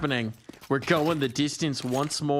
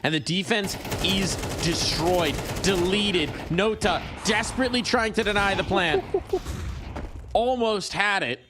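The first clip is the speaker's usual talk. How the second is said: excited caster; 6 semitones higher than usual. Real, not acted. Rising play-by-play call.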